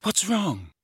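high-pitched, gently worried question; a touch of anxious concern. A narrator's reading. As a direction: ask with mild alarm. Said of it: "said Hermione in an anxious voice".

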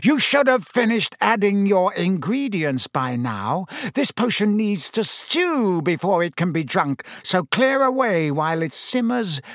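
commanding, ending slow and ominous; called out. Acted. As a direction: call instructions across a classroom. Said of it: "Snape called".

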